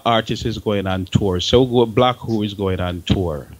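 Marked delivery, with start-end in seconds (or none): none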